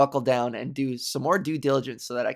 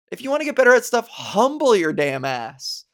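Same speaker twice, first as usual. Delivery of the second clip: fast, louder rallying urge; emphatic advice; 7 semitones higher, 5 dB louder than usual. Real, not acted. Urging listeners to stay humble, loud and emphatic. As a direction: hammer the point home. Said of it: "preaching humility".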